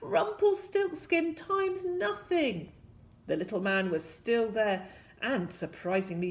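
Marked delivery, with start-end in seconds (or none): 0.0-2.7 high, straining storybook shout; shouted spell. A narrator's reading. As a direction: shout it slowly with all your might. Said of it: "with all his might and shouted".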